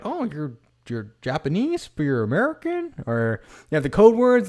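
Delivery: high, surprised, half-mocking disbelief; incredulous question. Soldier asking a Japanese American, in high mock disbelief. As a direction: ask it like you can't believe it.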